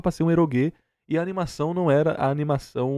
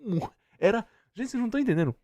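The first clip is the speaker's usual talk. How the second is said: normal talking voice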